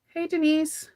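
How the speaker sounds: normal talking voice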